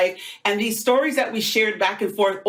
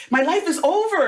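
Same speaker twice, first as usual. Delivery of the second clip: imitated tearful wail; 8 semitones higher than usual. Teen girl in the hallway tells Rosita her life is over, mimicked tearful high wail. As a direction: mimic a heartbroken girl, pitched up. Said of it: "she was in tears".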